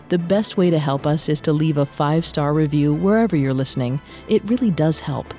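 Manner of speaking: ordinary talk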